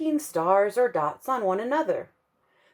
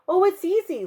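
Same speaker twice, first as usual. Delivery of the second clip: put-on high, cheerful young girl; sunny little girl, high and airy; bright girl voice; 12 semitones higher, 4 dB louder than usual. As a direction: voice Lucia light and high. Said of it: "Lucia replied".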